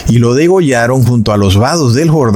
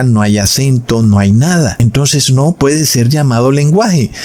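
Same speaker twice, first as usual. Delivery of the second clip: normal talking voice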